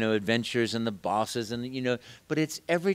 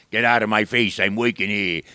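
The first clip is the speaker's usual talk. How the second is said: loud, gruff Brooklyn growl; gruff Brooklyn plumber; 8 dB louder than usual. Shown as a demonstration. Actor demos the rejected Brooklyn plumber voice, gruff and loud. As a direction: bark it like a tough Brooklyn plumber. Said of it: "the fun voice that came to my mind with Italian plumber from Brooklyn".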